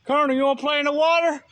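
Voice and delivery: loud high call to the dog. Real, not acted. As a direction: call him into the water. Calling dog to water.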